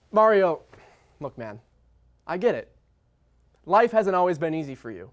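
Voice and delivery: ordinary delivery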